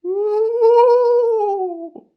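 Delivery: long, very high, loud "woo"; sustained high-pitched cheer; excited whoop. Real, not acted. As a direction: whoop loudly with delight. Cheering at game reveal.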